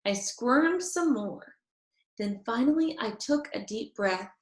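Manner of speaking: ordinary delivery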